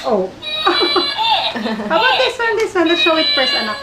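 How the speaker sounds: put-on high, sing-song baby talk; reading to a toddler. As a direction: coo each word to the baby. Showing toy animals to baby.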